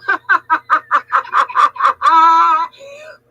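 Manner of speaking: loud burst of real laughter; bursting into loud laughter at being teased; big laugh. Real, not acted. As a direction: crack up out loud.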